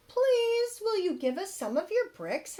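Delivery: high, pleading little wolf voices; little wolves. Acted. Wolf cubs ask the kangaroo for bricks, high and pleading. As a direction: ask sweetly like small cubs. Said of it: "asked the three little wolves".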